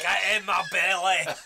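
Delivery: put-on Scottish accent, Mike Myers style; Fat Bastard impression. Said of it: "this is Mike Myers style".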